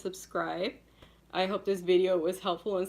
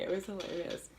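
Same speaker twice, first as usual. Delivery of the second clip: ordinary talk, nothing marked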